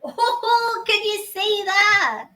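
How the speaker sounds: high, loud and drawn-out delight; thrilled high-pitched gush; excited squeal. Real, not acted. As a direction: squeal at the finished cake. Excited over cake, high and loud.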